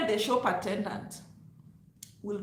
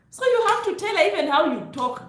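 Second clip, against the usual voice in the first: much higher and louder; loud demo; 9 semitones higher, 10 dB louder than usual. Shown as a demonstration. Showing immigrants their loud way of talking, loud. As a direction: show them how loudly we talk. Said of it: "I'm talking like this".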